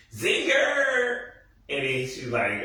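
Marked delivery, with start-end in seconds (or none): 0.1-1.3 shouted zinger. Retold or imitated. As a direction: yell "Zinger!" up high like a cheer. Cheering a comeback in the scene.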